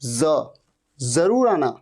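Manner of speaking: जरूर starting with a buzzing z; z sound. Shown as a demonstration. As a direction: say the z alone, then जरूर as "zaroor". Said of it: "ज नहीं".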